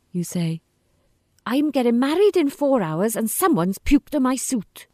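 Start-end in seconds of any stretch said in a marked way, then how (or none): 1.5-4.9 desperate. Acted. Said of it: "You sound desperate".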